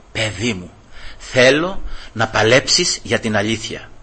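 soft, low, tender divine voice; voice of God. Retold or imitated. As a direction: speak as the Lord calling his child gently. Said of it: "τι γλυκιά φωνή που ήταν αυτή". (απαλή, χαμηλή φωνή του Θεού)

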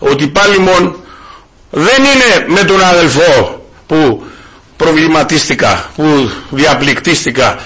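measured pulpit warning; solemn exhortation. Acted. Exhorting the congregation.

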